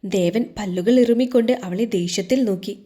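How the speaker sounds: usual speaking voice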